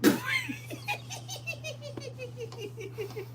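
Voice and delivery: high, breathy squeal of a laugh. Real, not acted. Laughing at the dog's greeting. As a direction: let a giggle squeak out.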